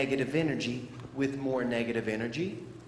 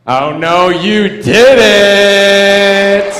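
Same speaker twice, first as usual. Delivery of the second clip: loud, sassy callout; sassy "oh no you didn't"; 5 semitones higher, 18 dB louder, much slower than usual. Retold or imitated. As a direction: throw attitude at him, full sass. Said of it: "No, he didn't say that".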